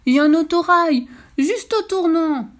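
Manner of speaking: high, loud warning, slowing at the end; frightened cow. Acted. The scared cow tells the fox a train is coming. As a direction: blurt the danger in fright.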